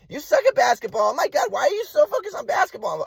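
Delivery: mock scolding, climbing to a high pitch; scolding mother imitation. Mom berating son about basketball, high-pitched mock scolding. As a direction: mimic mom's rising exasperation. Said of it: "She'd say".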